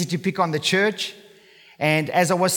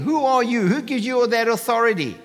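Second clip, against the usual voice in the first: retold shout; 6 semitones higher than usual. Temple crowd confronting Jesus over the tables, mild mock shout. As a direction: play the crowd's indignant outcry, half-hearted.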